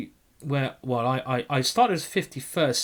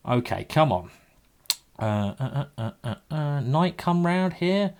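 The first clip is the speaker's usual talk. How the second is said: ordinary talk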